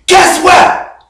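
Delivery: high-pitched, excited announcement; all excited. Acted. Friend telling you about his job at the company, excited. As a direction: share big news with an excited lift. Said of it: "He's all excited".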